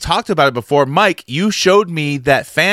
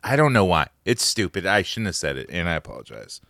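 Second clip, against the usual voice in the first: half-hearted apology; 8 semitones lower, 8 dB quieter than usual. Real, not acted. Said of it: "I half-heartedly".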